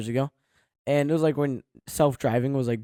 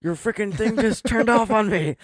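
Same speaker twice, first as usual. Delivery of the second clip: high-pitched, drawn-out mock outrage; indignant complaint; 8 semitones higher, 4 dB louder than usual. Passenger retelling driver's complaint about Tesla, mock indignant.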